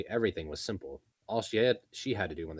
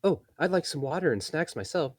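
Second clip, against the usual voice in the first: higher, louder call after him; 10 semitones higher, 6 dB louder than usual. Acted. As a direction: call across the room. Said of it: "calling after him".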